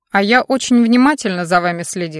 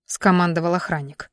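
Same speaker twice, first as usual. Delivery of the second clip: ordinary delivery, nothing marked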